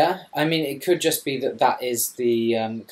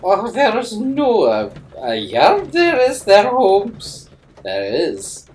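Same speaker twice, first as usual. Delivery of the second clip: higher, louder, slow hammed-up Scottish voice; mock Scottish accent; 13 semitones higher, 10 dB louder, slower than usual. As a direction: copy the Scottish joke and stretch it. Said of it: "That's what all of them sound like".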